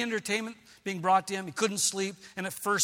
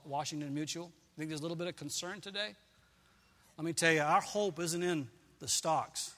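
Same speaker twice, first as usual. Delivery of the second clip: ordinary delivery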